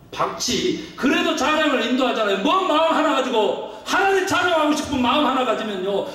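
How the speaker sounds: high, driving pulpit exhortation; impassioned preaching. Real, not acted. Preaching at full force.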